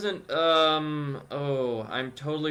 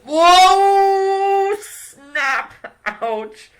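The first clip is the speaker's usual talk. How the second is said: much higher, loud startled yelps; mock surprised yelps; 12 dB louder, much slower than usual. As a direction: yelp like you got burned. Mock reaction to being burned.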